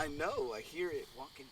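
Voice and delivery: whispered, very soft; hushed whisper. Real, not acted. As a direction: keep it down so it can't hear. Creature outside the tent.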